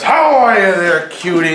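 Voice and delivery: slow, high-pitched mock come-on; Joe Boy's greeting. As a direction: imitate a buddy calling out to a woman. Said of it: "and Joe Boy goes".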